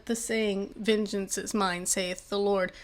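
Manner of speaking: usual speaking voice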